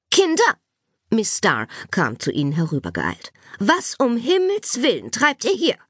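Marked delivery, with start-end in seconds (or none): 0.1-0.6 high, loud teacher's call; calling out sharply. A narrator's reading. Teacher rushes over, calling pupils. 3.6-5.8 high-pitched teacher's reproach; indignant scolding. A narrator's reading. Teacher confronts misbehaving pupils.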